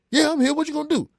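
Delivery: high, loud, combative comeback; defiant challenge. Retold or imitated. Prank caller challenging the rapper, loud defiance. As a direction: shout it back, daring him.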